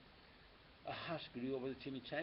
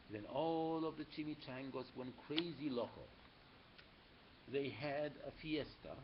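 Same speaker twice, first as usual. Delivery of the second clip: usual speaking voice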